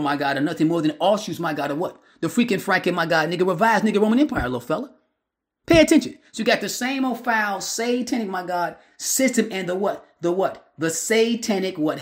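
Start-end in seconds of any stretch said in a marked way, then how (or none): 5.7-6.2 sudden much higher, louder Pay attention; shouted command. Real, not acted. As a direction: bark it out to wake the crowd. Preacher shouting at listeners.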